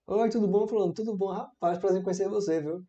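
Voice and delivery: breezy meet-and-greet; friendly greeting. Acted. Greeting someone just met, friendly. Acted greeting between two people.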